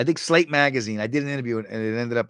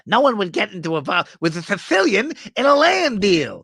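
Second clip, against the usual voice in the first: much higher, louder indignant character voice; Vizzini impression; 10 semitones higher, 7 dB louder than usual. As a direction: play the haughty schemer. Vizzini quote from Princess Bride.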